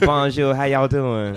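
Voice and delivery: slow, high hammed-up French accent; playful improvised French accent character greeting; mock French accent. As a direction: greet like a cheesy French-accented tough guy. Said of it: "French Jackson sounds amazing".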